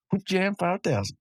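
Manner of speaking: high, playful repeat of the title; playful silly voice. Pretend. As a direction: savour the silly name. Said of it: "It's just a funny sound".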